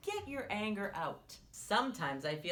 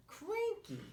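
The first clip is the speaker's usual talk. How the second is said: cranky; 13 semitones higher than usual. Shown as a demonstration. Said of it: "that's another word for angry".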